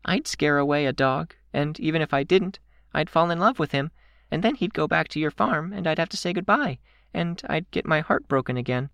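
very high, small character voice; downhearted girl character. A narrator's reading. Kenzie dreading goodbye to a dog, downhearted high voice. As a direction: fret in a little high-pitched voice.

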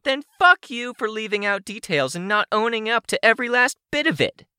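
very high, furious villainess rant; high, indignant character voice; angry outburst. Acted. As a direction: cut in, railing at a high pitch. Cuts Rain off in a rage.

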